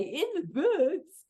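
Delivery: slow, sing-out self-parody; self-mocking. Real, not acted. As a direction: draw out your own pet phrase. Self-mocking repeat of own catchphrase.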